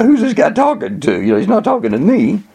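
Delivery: put-on high-pitched, incredulous boy; acted disbelief. Boy doubts coach addresses him.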